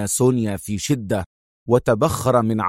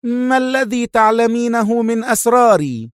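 higher, louder menacing question; frowning threat; 11 semitones higher, 4 dB louder than usual. Acted. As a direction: press her with a frown. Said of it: "انعقد حاجبا سونيا في شدة".

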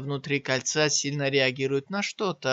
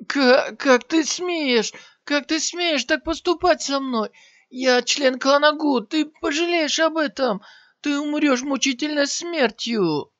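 much higher, louder stammering outraged scream; 11 semitones higher, 4 dB louder than usual. Acted. Armless warrior screams threats at Shiyan. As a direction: shriek the threats in pain and outrage. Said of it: "закричал воин".

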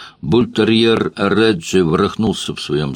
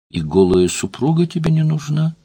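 calm, alluring voice; 9 semitones higher than usual. A narrator's reading. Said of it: "совершенно спокойным, завлекающим голосом".

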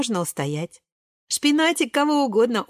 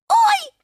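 very high, loud yelp; high-pitched pained squeal; 6 dB louder than usual. A narrator's reading. Шпинатик running into nettles, high squeal. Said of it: "визжит Шпинатик".

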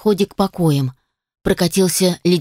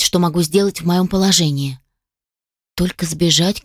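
ordinary delivery

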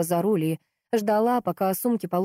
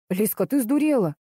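indignant, higher pitch; grumbling protest; 4 semitones higher, 3 dB louder than usual. A narrator's reading. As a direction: protest in disbelief. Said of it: "взроптал Кирилл". (возмущённо ропща)

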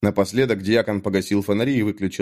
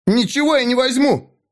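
much higher, squealing refusal; shrill refusal; 13 semitones higher, 3 dB louder than usual. A narrator's reading. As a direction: shriek it, cornered. Mik refuses in terror. (Мик визгливо отказывается)

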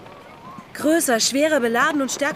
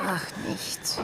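soft evasive whisper; 4 semitones lower, 9 dB quieter than usual. Acted. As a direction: breathe it away, hiding something. (leises ausweichendes Flüstern)

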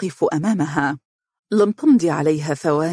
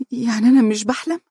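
high, fast, breathless can't-believe-it question; on the edge of tears, not daring to believe; stunned disbelief; 7 semitones higher, faster than usual. A narrator's reading. Wife touching her husband's face, high stunned disbelief. She disbelieves, then starts crying.

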